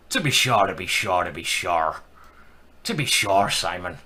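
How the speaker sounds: stage Irish, punched start then dropping low; mock Irish catchphrase voice; hammed-up Irish accent. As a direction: put on a jokey Irish brogue. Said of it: "Irish accent is absolutely brilliant".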